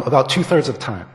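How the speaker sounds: ordinary talk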